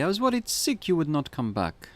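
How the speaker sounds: a worried wife in a mock Eastern European accent. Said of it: "That's my Eastern European accent".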